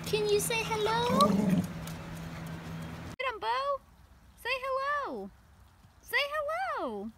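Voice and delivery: high, soft coaxing to pet; teaching a parrot. Real, not acted. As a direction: coax the dog to say hello. Coaxing dog to say hello.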